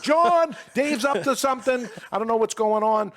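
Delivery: high, loud call across the house; mother yelling. Retold or imitated. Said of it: "My mother yells to my father".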